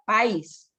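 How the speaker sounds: "país" as one syllable, "páis"; reading without accent. Shown as a demonstration. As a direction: glide from stressed A into I in "país". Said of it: "Si no le pone tilde".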